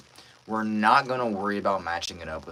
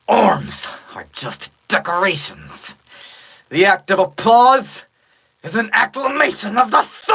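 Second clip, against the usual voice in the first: high, very loud villain roar; villain's shouted proclamation; 11 semitones higher, 10 dB louder than usual. Acted. Villain's speech building to a shout.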